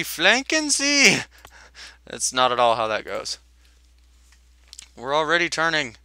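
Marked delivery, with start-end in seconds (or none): 0.0-1.2 higher, slow German officer shout; shouted German command. Acted. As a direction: bellow it like a German captain. Mock German order, repeated.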